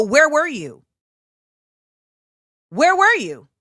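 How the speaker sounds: pointed demand, pitch up then falling; mildly heated retold demand; confronting. Performer coming off stage to the absent colleague, confronting. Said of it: "come off stage and go".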